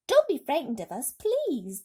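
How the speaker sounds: high, loud reassuring call. A narrator's reading. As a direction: call down kindly to the crowd. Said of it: "James called out".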